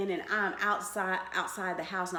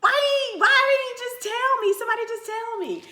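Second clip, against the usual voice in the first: much higher, louder, pleading; upset protest; 6 dB louder than usual. Retold or imitated. Girlfriend protesting to mother, high pleading. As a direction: demand to know why. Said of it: "I'm like".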